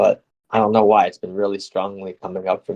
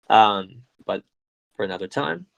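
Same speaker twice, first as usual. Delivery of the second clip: normal talking voice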